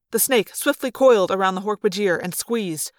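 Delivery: plain reading voice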